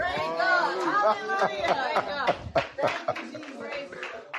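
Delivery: long, high-pitched laugh of pure delight. Real, not acted. Pastor laughs at congregation, a long high delighted laugh.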